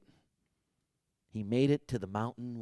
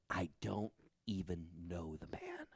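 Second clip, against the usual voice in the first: softer, whispered under breath; whispered denial; 6 semitones lower, 11 dB quieter than usual. Acted. Peter denying Jesus, a hushed whisper. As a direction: deny him in a whisper.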